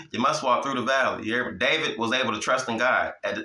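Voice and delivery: usual speaking voice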